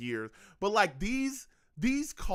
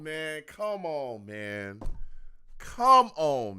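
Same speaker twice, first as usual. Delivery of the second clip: ordinary talk, nothing marked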